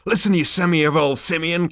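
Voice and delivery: loud, high-pitched scolding; angry insult. Acted. Zaphod cuts in on Arthur, scornfully angry. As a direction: cut in with a loud put-down.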